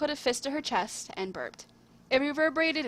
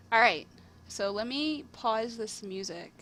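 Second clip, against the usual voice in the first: usual speaking voice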